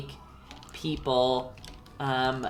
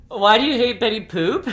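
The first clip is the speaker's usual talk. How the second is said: laughing; 6 semitones higher, 7 dB louder than usual. Real, not acted. Mock offense at chat.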